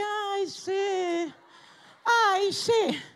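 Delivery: a light mock of a weary, half-hearted "ay, sí"; lightly mimicking a spiritless believer; mock lazy sigh. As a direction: drag it out like shuffling in slippers. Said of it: "arrastrando la chancleta de que".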